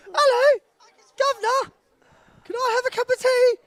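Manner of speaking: high, put-on posh English lady, polite; mock posh lady voice. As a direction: ham up a very high refined lady ordering tea. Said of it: "my most feminine voice".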